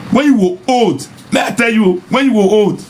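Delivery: high-pitched, teasing warning; taunting warning. Real, not acted. Vlogger warning men about old age, taunting. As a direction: pitch it high and needle them.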